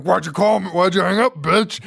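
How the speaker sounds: hammed-up phone threat; mock threatening caller. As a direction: imitate a prank caller menacing his victim. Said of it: "it's like he threatens her".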